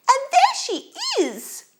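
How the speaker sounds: very high, loud squealed discovery; squeaky Baby Bear. A narrator's reading. Baby Bear spots the sleeping girl, piercing squeak. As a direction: squeak it out like a startled cub. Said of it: "squeaked Baby Bear".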